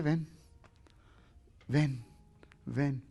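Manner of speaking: soft, low, compassionate call; gentle invitation. Acted. Jesus in his compassion invites the believer, gentle invitation. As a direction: repeat it softly with space between.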